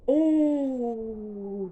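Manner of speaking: sharp high gasp; real gasp. Real, not acted. Watching the Lions game, real gasp of surprise. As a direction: gasp at the play.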